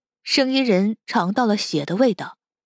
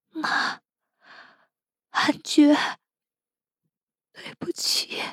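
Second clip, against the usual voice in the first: breathy, broken near-whisper; despairing farewell; 7 semitones higher, 4 dB quieter, slower than usual. Acted. Captive apologises to loved ones before dying, whispered despair. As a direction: gasp out a last apology, softly.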